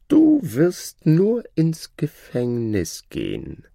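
high, lordly and condescending; haughty shadow. Acted. The Shadow lording it over the learned man, haughty high voice. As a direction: talk down to your old master.

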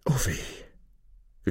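soft, breath-filled lament; despairing. A narrator's reading. Wife laments her husband's deed, despairing and hushed. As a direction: sigh it out in despair. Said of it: "rief seine Frau verzweifelt".

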